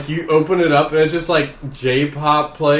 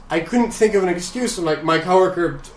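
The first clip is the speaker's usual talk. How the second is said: usual speaking voice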